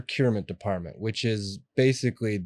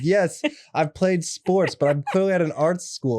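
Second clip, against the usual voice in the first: indignant retort; 6 semitones higher than usual. Retold or imitated. Mocking classmates who called him jock.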